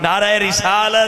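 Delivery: high, slow, ringing call to the crowd; drawn-out slogan call. Real, not acted. Preacher calling the crowd to chant back, drawn out and high. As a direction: stretch the slogan out for the crowd to answer.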